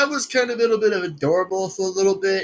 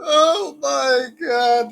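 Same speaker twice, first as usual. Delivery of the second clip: laughing; 6 semitones higher, 3 dB louder, much slower than usual. Real, not acted. Still laughing at clip.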